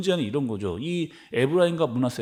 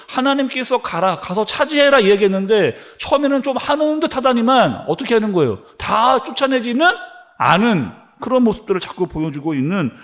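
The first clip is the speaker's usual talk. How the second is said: louder, higher preaching at full force; forceful preaching; 3 semitones higher, 4 dB louder than usual. Acted. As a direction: drive the sermon home with force. (힘차게 외치는 설교)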